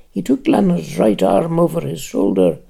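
usual speaking voice

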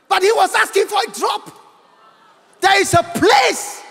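full-force pulpit shout about a drop of water; preaching shout. Real, not acted. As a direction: plead it out to the crowd. Preacher crying out about hell.

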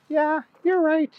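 put-on high-pitched little girl voice. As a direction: talk high like a small girl.

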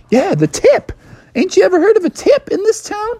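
high-pitched, exasperated outburst; indignant demand. Acted. Bubby asks unicorn for his tip, high indignant voice.

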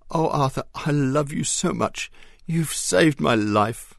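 grateful purr. A narrator's reading. Mary thanking Arthur for finding her ring, grateful purr. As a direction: melt with gratitude. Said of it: "purred Mary, her beautiful voice tinged with gratitude".

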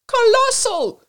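high, loud yelled praise; big high-pitched yell. A narrator's reading. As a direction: bellow your admiration over the noise. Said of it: "yelled Whimsy".

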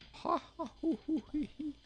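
very high echoing hoo-hoo-hee; thin high acted laugh, dismally echoed; half-merry, half-sad laugh. A narrator's reading. Well echoes the king's laugh, high and half sad. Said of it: "a laugh half merry and half sad".

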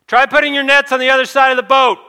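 high, very loud called instruction; yelling out. Acted. Stranger on the beach directs the fishermen, yelling. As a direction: holler it all the way out to the boat. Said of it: "the man yells out".

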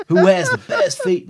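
laughing stab at an American accent. Shown as a demonstration. Co-host retrying the Louisiana request, same American accent. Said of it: "No, same one".

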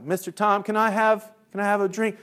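put-on high child voice; kids pleading. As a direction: imitate children asking the teacher. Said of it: "they're like".

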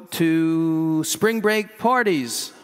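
slow, deliberate, every word weighed. Real, not acted. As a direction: lay it out slowly as a sermon point. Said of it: "Why do I have that in here?".

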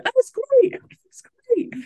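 very high, falsely bright reassurance; forced cheerfulness. Retold or imitated. New mum answering touchline parents while hiding a cancer diagnosis, forced bright chirp. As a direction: paste on a chirpy voice to hide panic.

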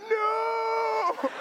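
high, anguished mock wail; anguished cry. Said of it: "my heart was like".

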